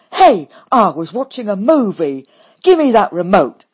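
loud, angry shout for the remote; loud indignant cry. A narrator's reading. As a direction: yell at her sister indignantly. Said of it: "cries Dolly".

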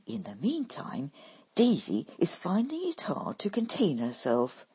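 ordinary delivery, nothing marked

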